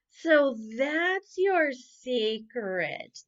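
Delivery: drawn out, slow and pleased; slow knowing remark. Acted. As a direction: savour the discovery slowly. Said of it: "they said".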